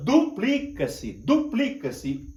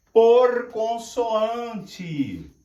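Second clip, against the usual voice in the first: 1 very slow dictation; 3 semitones higher, 3 dB louder, much slower than usual. Shown as a demonstration. Teacher dictates while writing.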